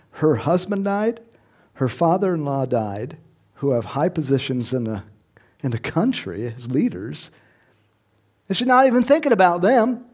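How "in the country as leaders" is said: normal talking voice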